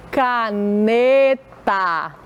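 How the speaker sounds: "Caneta" in three long pieces, ca, ne, ta; syllable-by-syllable reading. Shown as a demonstration. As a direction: sound out "Caneta" very slowly. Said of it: "Vamos ler com a tia".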